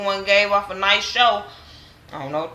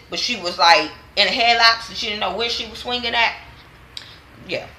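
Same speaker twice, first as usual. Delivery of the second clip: ordinary talk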